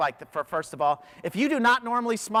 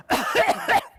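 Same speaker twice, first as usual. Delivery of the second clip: a loud open cough, no hand over the mouth; 4 dB louder than usual. Retold or imitated. Speaker shows his loud elevator cough. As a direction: cough loudly to show the scene. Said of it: "started coughing really loud".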